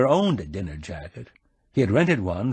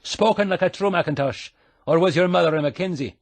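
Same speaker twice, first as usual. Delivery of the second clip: fast, higher teasing Scottish brogue; 8 semitones higher than usual. A narrator's reading. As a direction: needle him in a quick Scottish brogue. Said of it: "putting on the brogue".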